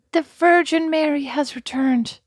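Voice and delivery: high, slow, reverent announcement; mock awed diners. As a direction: proclaim it in mock awe. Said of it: "They'd probably be like".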